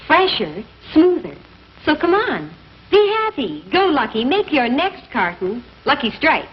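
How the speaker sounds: usual speaking voice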